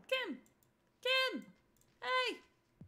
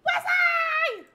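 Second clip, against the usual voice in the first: excited shout; 14 semitones higher, 12 dB louder than usual. Real, not acted.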